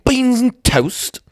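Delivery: high, drawn-out mock British; hammed-up slow British exclamation; British accent. Shown as a demonstration. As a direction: stretch it in a silly British voice. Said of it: "Can do British".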